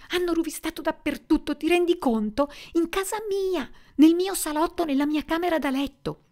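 furious. Acted. Susan furious after burglary.

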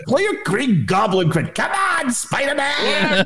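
very high, drawn-out mock wail; exasperated plea. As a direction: cry out high, stretching "Spider-Man". Exasperated plea for Green Goblin clip.

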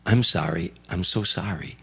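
soft, lost apology into the mic; imitating a whisper. A comedian retells the star apologising softly to the arena. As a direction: murmur it like a singer who forgot the words. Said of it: "he started whispering into the microphone".

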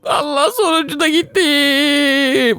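very high, crying shriek of fright; frightened scream. Real, not acted. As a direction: scream out in real panic, then gasp. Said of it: "Biraz bağırdım ama kusura bakmayın". (çok tiz, korku dolu çığlık)

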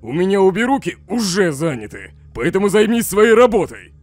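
building to a loud, annoyed order; angry dismissal. Acted. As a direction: shut the conversation down with irritation. Shuts down the topic, annoyed.

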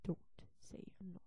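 whispered warning; hushed. Acted. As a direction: warn under your breath.

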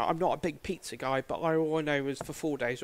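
normal talking voice

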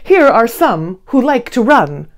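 ordinary delivery, nothing marked

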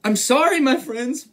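high, lightly mournful apology; a touch of storybook sadness; sad elephant. A narrator's reading. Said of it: "said the elephant sadly".

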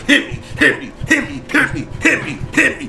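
high-pitched, rapid repeated dare; frantic goading. Real, not acted. Player daring Freddy to attack, high and frantic. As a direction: egg him on in a high voice.

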